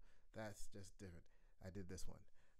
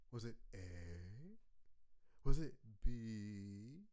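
slow drawn-out; 4 dB louder, much slower than usual. Shown as a demonstration. Drawn-out quiz options.

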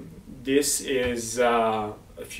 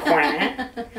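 French duck quack, higher, rising at the end; French accent; 5 semitones higher, 4 dB louder than usual. Shown as a demonstration. Demonstrating how a duck goes in France, playful French accent. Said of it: "With the French accent, it goes".

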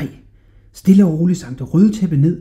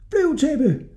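put-on boy's shouted command; 13 semitones higher than usual. A narrator's reading. Hodja ordering the carpet to fly, calling out. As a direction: call out high to the carpet. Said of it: "råbte han".

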